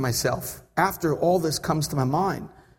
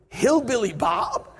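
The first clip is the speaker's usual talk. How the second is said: slow, higher incredulous question; mock disbelief; 5 semitones higher, slower than usual. Passenger eyeing his unwanted seatmate, mock incredulous. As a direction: draw the name out in mock disbelief.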